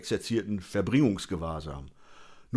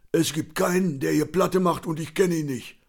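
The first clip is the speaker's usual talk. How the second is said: high, cocky character voice; put-on big-talking drinker; boastful; 7 semitones higher than usual. A narrator's reading. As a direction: brag with puffed-up certainty in a raised pitch. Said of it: "meinte er dick".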